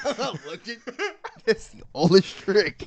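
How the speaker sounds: high, laughing mock quote; laughing echo. Echoing a friend's retold joke, laughing.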